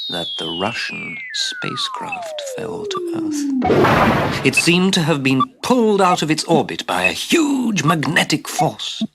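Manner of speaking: high, slow, awed announcement; dramatic wonder. A narrator's reading. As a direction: unveil it like a sci-fi spectacle. Dramatic spacecraft arrival.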